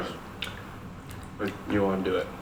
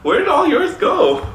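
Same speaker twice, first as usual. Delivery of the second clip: surprised; 7 dB louder, slower than usual. Real, not acted. Surprised at empty crab shell.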